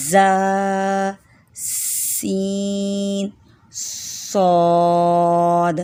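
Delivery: زا سین صاد drawn very long with a hissing whistle; sharp whistled letter demo; whistling letters. Shown as a demonstration. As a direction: hiss each letter hard and long. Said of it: "تھوڑی سی سیٹی اور پھر سختی".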